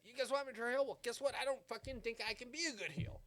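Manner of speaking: high, loud promo voice, settling by the end; mock wrestler promo, half earnest; challenging Cody. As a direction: confront the crowd, then admit it. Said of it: "he's like".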